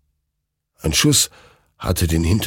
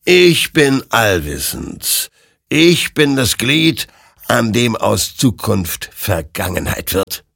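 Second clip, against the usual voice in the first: slow, raised proclamation; omniscient creature, solemn; 5 semitones higher, 4 dB louder than usual. Acted. Creature declares its omniscience to Hector, slow and portentous. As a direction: proclaim it like an all-knowing being. Voices the all-knowing creature.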